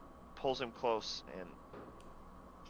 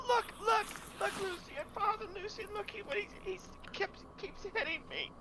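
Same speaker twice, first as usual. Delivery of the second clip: put-on very high, whiny child pleading; a small boy tattling in a panic. Boy pleads, accusing the other.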